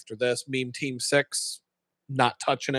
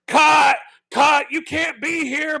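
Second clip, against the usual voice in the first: loud, much higher shouts; crew yelling; 12 semitones higher, 6 dB louder, slower than usual. Retold or imitated. Film crew yelling to clear the set, loud shouts. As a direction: bark orders across the lot.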